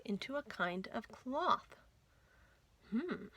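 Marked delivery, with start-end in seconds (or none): none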